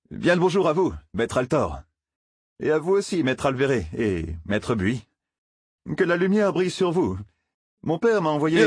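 butter up the grown-ups; eager polite boy. Acted.

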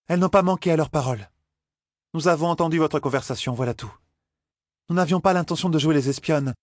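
high-pitched character voice, explaining; earnest young companion. Acted. Companion owning up to overhearing and offering help, earnest high voice. Elaine pleads with Liandrine in story.

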